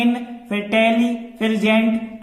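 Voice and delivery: इन, टेली, जेंट said as separate beats; syllable by syllable, like a teacher; split into syllables. Shown as a demonstration. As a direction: break इंटेलिजेंट into इन - टेली - जेंट, a small pause after each part. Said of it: "तीन खंडों में उच्चारण".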